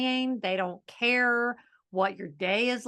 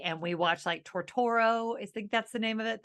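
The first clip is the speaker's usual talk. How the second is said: normal talking voice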